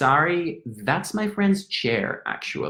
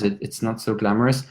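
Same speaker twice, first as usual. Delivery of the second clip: ordinary talk, nothing marked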